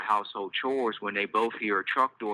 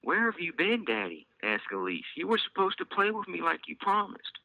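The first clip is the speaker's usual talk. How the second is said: put-on little girl voice; 9 semitones higher than usual. A narrator's reading. Little girl scolding daddy.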